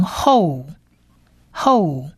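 后 drawn out as "hol", falling; sounding out 后. Shown as a demonstration. Teacher adds lamed to the syllable, drawn-out 后 demo. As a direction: model the blended syllable 后, then repeat it. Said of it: "就变成".

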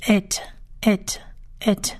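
"it" as et, eh plus a released t; crisp final t on "it", vowel as eh; model pronunciation. Shown as a demonstration. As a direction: repeat "it" three times, clearly separated. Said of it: "这个字连起来就是".

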